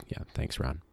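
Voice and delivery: whispered flat sarcastic thanks; deadpan the annoyed dad's thanks; dry sarcasm. Retold or imitated. Dad staring at Santo, sarcastic whispered thanks. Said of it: "dad's kinda staring at him like".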